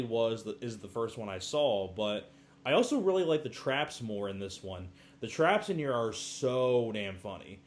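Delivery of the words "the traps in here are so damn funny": ordinary delivery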